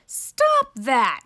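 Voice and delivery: loud, high-pitched cry to stop; scream. Acted. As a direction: yell at the baby to stop. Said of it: "screamed Uncle Ned".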